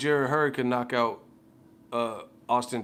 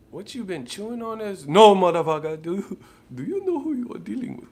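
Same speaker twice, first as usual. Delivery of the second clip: much higher, loud put-on indignation; mock outraged big shot; 8 semitones higher, 6 dB louder than usual. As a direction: act offended royalty. Acting an outraged figure.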